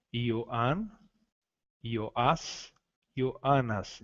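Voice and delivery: Ioan, Ioás, Ioanas each voiced syllable by syllable; Greek pronunciation. Shown as a demonstration. (nomes gregos Ioan, Ioás, Ioanas com I inicial)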